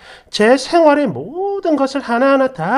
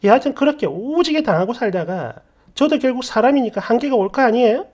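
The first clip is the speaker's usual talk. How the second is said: plain reading voice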